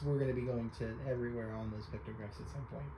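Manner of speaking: ordinary talk, nothing marked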